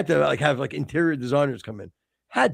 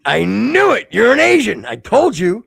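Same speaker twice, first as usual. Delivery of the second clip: louder, higher I-told-you-so; triumphant gotcha; 6 semitones higher, 8 dB louder than usual. Real, not acted. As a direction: crow over being proven right.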